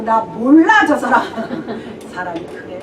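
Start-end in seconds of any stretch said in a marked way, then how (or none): 0.0-1.2 emphatic denial. Acted. Peter swearing denial, acted.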